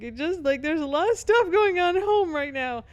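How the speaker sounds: high, loud mock wail; retold crying breakdown. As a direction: imitate blurting an excuse through tears. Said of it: "broke down crying".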